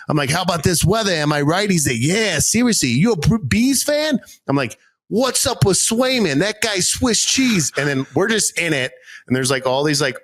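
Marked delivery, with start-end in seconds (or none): 0.3-7.7 higher, hammed Boston sports talk; thick Boston banter; Boston accent. Boston small talk with driver, Boston accent. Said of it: "having a Boston moment".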